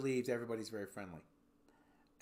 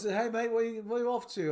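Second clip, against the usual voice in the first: higher, chummy mock British accent; imitated British accent; 8 semitones higher, 4 dB louder than usual. Local greeting an American visitor, British accent. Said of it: "you don't have to do the accent".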